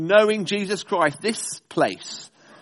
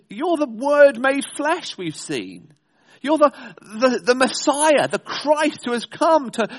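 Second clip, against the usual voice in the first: high, rising disbelief, louder at the peak; incredulous outcry; 6 semitones higher, 3 dB louder than usual. Retold or imitated. Preacher questioning Jesus, incredulous and raised. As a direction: build a pleading, astonished protest.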